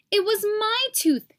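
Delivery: high, loud protest, tooth gone; upset child. Acted. Boy upset at his mom after losing his tooth, high protest. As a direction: wail it like a robbed kid.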